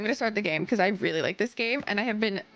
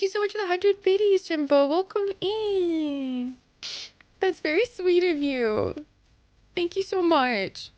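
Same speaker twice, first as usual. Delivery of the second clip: sweet cooing thanks; 6 semitones higher, slower than usual. Pretend.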